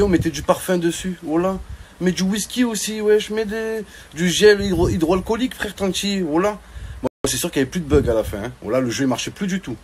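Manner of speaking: ordinary talk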